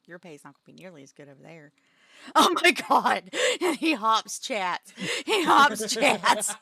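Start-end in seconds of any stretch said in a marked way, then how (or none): 2.4-6.6 loud, slow laughing yell; laughing outburst. Real, not acted. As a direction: shout it while cracking up. Laughing outrage at the joke.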